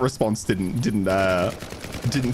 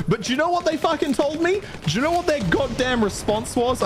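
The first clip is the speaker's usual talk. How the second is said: exasperated disbelief; 13 semitones higher than usual. Real, not acted. Incredulous rant about council reply.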